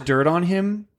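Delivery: ordinary talk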